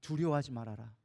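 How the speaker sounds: low, measured divine command; calm reassurance. Acted. God speaking to frightened Joshua, calm reassurance.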